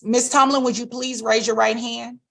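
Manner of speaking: ordinary talk, nothing marked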